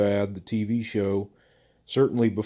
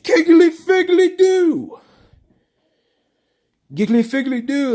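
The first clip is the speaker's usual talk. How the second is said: high-pitched, hammed-up comedy battle cry; absurd catchphrase; 7 dB louder than usual. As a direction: belt the nonsense phrase like a goofy stand-up gimmick. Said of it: "what kind of a phrase would he come up with".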